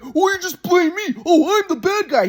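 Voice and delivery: high mocking self-pity, slowly; mock the grandpa's sarcastic whine. Mocking grandson imitates sarcastic grandpa. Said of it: "sarcastic and".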